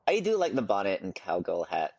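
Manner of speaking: normal talking voice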